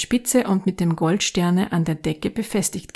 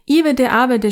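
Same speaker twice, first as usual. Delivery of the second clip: higher, louder shouted title; a hint of kids shouting; children shouting; 4 semitones higher, 4 dB louder than usual. A narrator's reading. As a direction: yell out your choice. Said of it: "schrien einige".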